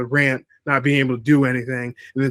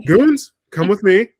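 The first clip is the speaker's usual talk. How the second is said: raised, loud mock [name]; [name] impression; 6 semitones higher, 4 dB louder than usual. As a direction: play a bossy mock [name]. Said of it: "It's like".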